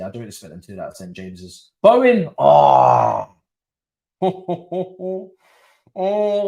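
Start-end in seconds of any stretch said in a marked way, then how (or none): 1.8-6.5 loud sudden yell of the name; excited shout. Real, not acted. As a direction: burst out as the shot flies. Big chance in live match.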